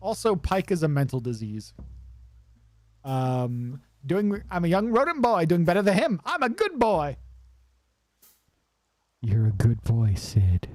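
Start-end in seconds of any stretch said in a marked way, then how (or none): 4.1-7.2 high, proud little rodent voice; Sid voice. Acted. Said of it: "I like voicing Sid". 9.2-10.7 much lower, gruff fond voice; older character praising Sid, low and gruff; deep patting reply. Acted. Deep reply voicing another character.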